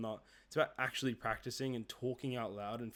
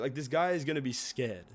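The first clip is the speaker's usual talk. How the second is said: ordinary talk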